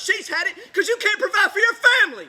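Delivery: loud, desperate shouting at the Internet; angry outburst. Acted. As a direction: voice rising into a raw, loud rant. Breaks down ranting at the Internet.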